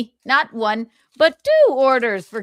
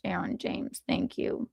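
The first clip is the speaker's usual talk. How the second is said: soft whisper, breath only; whispered thanks; 2 semitones lower, 10 dB quieter than usual. Real, not acted. Soft aside thanking a donor.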